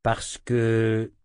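hesitant wolf, leaving it hanging; hésitant. A narrator's reading. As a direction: start to explain, then hold back. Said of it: "hésitant". (début de phrase hésitant)

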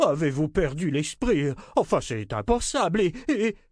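high, spluttering chancellor in panic; panicked outcry. A narrator's reading. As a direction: protest wildly and run out of words. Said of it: "s'écria le chancelier". (protestation affolée, voix haut perchée)

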